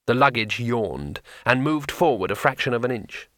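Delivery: usual speaking voice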